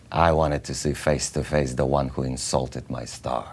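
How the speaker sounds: low, soft, measured confrontation; imitating a quietly offended director; stern director. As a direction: quote him gravely, pitched well down. Director confronting her at the callback.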